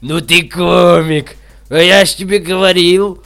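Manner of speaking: loud, high guffawing words; laughing loudly. A narrator's reading. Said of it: "земнопоний заржал". (со смехом, громко)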